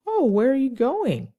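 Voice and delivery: high, swooping conversational question; acted question. Shown as a demonstration. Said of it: "a logical question would be".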